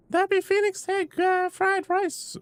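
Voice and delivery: put-on very high, squeaky child-like voice; wrong character's voice. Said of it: "Wait, that's the wrong voice".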